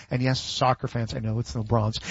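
dropped low and soft, a sheepish aside; low, soft aside to the audience; quiet aside. Real, not acted. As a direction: murmur it to the soccer fans as a knowing nod. Aside to soccer fans on pronunciation.

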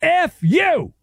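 high-pitched, drawn-out mock yell. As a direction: imitate an official yelling, pitch jumping up.

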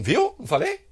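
high, quick rhetorical questions; mock I-told-you-so. Commentator imitating Eduardo's post, mock I-told-you-so. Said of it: "Eduardo publica na linha assim".

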